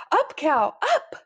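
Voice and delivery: loud command leaping high at the end; urgent shout to a steed; crying out. A narrator's reading. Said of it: "Granddude cried".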